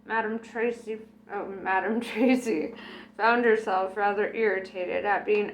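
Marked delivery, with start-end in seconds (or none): none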